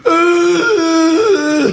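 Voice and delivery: high-pitched mindless whoop; mock drawn-out fan holler; stoned crowd cheer. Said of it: "eight people out of twenty thousand to go".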